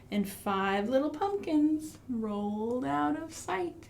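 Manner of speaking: rhythmic storytime chant; finger-play rhyme. Pretend. Teacher reciting a finger-play rhyme to children, rhythmic. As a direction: recite it like a nursery rhyme for toddlers.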